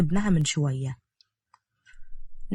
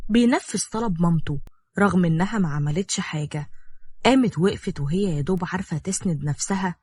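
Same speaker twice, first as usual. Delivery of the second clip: usual speaking voice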